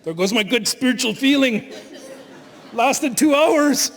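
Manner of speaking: hammed-up outburst, stretched out at the end; mock road rage. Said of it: "especially with my road rage".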